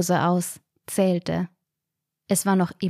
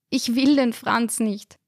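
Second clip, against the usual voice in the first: sobbing; 5 semitones higher, slower than usual. A narrator's reading. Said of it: "schluchzte Resi".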